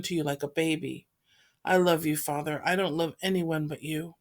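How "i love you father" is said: ordinary delivery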